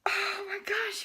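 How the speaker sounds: unvoiced, stunned whisper; hushed oh my gosh; whispered gasp. Real, not acted. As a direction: breathe it out in shock. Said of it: "I am shaking".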